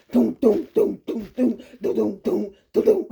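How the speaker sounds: low rhythmic doom doom; mock marching beat; imitating marching. [name] mocks the waiter's march, low chant. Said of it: "she started imitating him".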